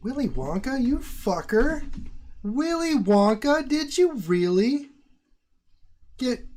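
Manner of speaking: loud, high, slow overjoyed shout; thrilled cry to a donor; excited. Real, not acted. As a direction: shout thanks in disbelief. Thanking a generous donor.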